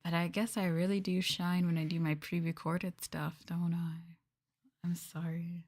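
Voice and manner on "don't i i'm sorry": whisper. Real, not acted. Teasing aside.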